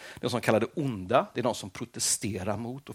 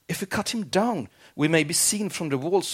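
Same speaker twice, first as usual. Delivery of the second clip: higher-pitched mild protest; objecting; 8 semitones higher, 3 dB louder than usual. A narrator's reading. Nomad objects to his chief's plan, mild protest. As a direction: raise the pitch to voice a worried objection. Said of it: "objected one of the nomads".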